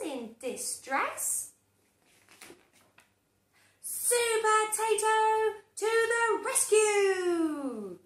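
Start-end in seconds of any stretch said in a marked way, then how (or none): 3.8-8.0 high, loud, slow hero fanfare; heroic announcement. A narrator's reading. Narrator hailing the hero, loud and slow.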